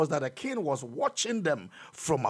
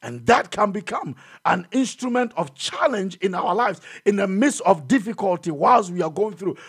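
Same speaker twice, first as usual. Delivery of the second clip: normal talking voice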